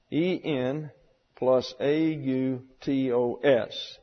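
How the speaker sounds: slow letter-by-letter spelling; teacher spells the Greek for the class, slowly. Shown as a demonstration. Spell E N plus A U T O S slowly, one letter at a time. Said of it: "So we have in plus autos".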